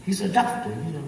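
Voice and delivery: normal talking voice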